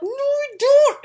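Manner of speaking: high, loud, slow mock snap; angry refusal. Harry furiously turning Lupin away, loud and high. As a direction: bite out each word.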